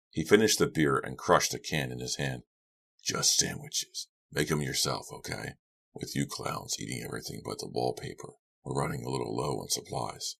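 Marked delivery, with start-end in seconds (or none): 3.0-10.4 low, soft man's voice; gruff drinker. A narrator's reading. Lou grudgingly offering lunch, low and offhand. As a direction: drop low and quiet, offhand.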